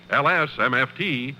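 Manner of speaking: ordinary delivery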